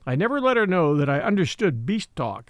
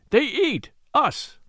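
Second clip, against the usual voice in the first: frightened bullock voice; 8 semitones higher, slower than usual. Acted. Scared bullocks flee.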